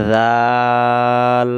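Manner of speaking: teacher models জাল for students to repeat; model pronunciation. Shown as a demonstration. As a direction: stretch জাল out for learners. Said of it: "একবার উচ্চারণ করেন".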